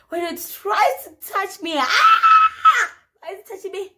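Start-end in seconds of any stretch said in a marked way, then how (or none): 1.8-2.9 very high, loud shriek of pain; long hammed-up pain scream. Injured and touched by a helper, piercing mock scream. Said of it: "guys, I was screaming".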